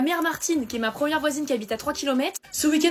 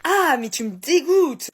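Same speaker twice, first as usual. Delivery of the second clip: louder, slow drawn-out disgust; 6 dB louder, much slower than usual. Acted. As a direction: recoil in disgust, stretching it out. Dégoût après un rot. (dégoût appuyé après le rot, lent et fort)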